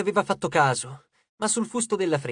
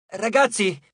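higher, raised call to the group; mild call for attention; 5 semitones higher, 3 dB louder, slower than usual. A narrator's reading. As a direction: call down to friends from above. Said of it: "gli richiamò Nico dall'albero maestro".

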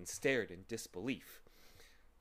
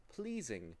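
ordinary delivery, nothing marked

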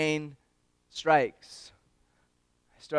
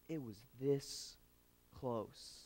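much softer, hushed through the fence; soft fatherly hush; 16 dB quieter, slower than usual. Retold or imitated. Father leans on the fence to tell his son, softly. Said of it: "And he said".

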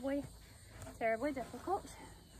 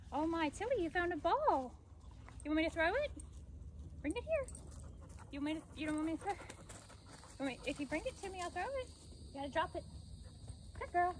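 talking to the dog; 5 semitones higher than usual. Real, not acted. Playing ball with her dog.

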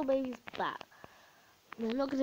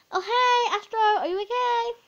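calling out; 12 semitones higher, 8 dB louder than usual. Real, not acted. Calling to pet in game.

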